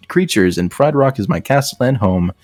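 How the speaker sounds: ordinary delivery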